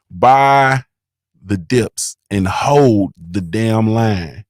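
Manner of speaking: loud "Buy", then slow "the dips and hold the damn line"; drawn-out slogan. Retold or imitated. Reading a viewer's super chat out as a slow rallying cry. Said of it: "He says".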